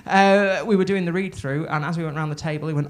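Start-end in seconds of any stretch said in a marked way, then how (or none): none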